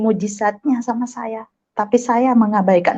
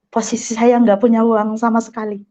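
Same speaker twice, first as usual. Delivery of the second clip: usual speaking voice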